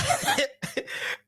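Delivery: a high, soft giggle burst; high laugh. Real, not acted. Streamer giggling at his own joke, high laugh. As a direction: giggle helplessly.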